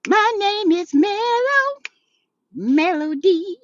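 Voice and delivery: high, slow, sultry self-introduction; playful imitation of Melody introducing herself; sultry intro. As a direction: purr the name out slowly like a diva. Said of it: "She said".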